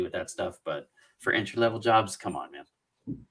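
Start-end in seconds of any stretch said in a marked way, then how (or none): none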